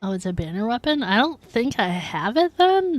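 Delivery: ordinary talk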